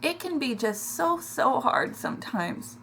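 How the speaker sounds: fighting back tears, barely getting it out; choking up. Real, not acted. Telling viewers about family strife, real tears. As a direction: voice cracking, slow and breaking on so. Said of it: "This is an emotional one this week".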